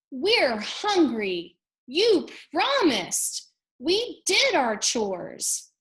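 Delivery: high, loud, slow whining; drawn-out childish protest; whiny complaint. Acted. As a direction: complain like hungry little chicks. Chicks complain to Hen.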